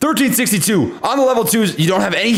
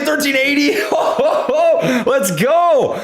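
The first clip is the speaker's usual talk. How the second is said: laughing delight; 7 semitones higher, slower than usual. Real, not acted. Price hits new mark.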